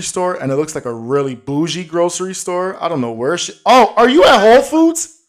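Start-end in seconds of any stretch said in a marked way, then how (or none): 3.6-5.2 high, loud hometown hype; excited. Real, not acted. Host hyping his hometown to Jasmine, high excited shout. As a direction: shout out Brooklyn with glee.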